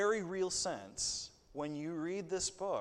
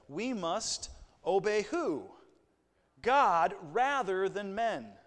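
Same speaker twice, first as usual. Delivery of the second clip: slow, loud and ringing; emphatic slow declaration, louder at the end; bold proclamation; 4 semitones higher, 7 dB louder, slower than usual. Acted. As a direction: proclaim it like Peter before the council. Preacher voicing Peter's answer.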